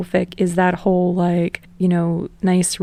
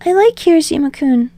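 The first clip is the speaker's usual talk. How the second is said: higher, louder eager Deku voice; rambling; 8 semitones higher, 3 dB louder than usual. Acted. Deku rambling to Bakugo on the phone, higher and eager. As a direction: babble on nervously without a breath.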